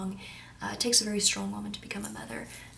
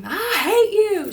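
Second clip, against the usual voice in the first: loud, higher, slow mock teen outburst; teen yelling; 10 semitones higher, 10 dB louder, much slower than usual. Teen shouting at mother before slamming the door. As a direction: spit it out like a sulky teenager. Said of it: "we'll say".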